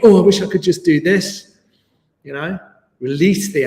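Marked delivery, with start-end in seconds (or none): 0.0-1.6 mock frustrated student. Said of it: "Do not think".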